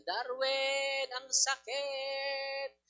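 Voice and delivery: high, slow pained demon cry building to a shout; groan, then yell as tortured demons; wailing demons. Retold or imitated. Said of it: "Sabi ng mga demonyo".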